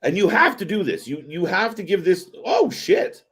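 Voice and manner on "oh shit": sudden high startled curse; surprised. Real, not acted. Host interrupted by a sudden spot in the match, startled. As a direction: blurt it out high, caught off guard. Sudden reaction mid-sentence.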